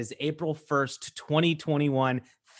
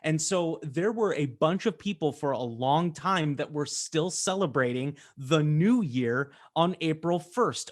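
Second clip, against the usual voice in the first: ordinary talk, nothing marked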